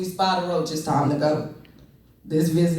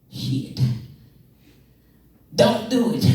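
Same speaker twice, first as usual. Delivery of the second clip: grandpa's warning; 5 semitones higher, slower than usual. Retold or imitated. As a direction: hit the punchline hard. Grandpa punchline.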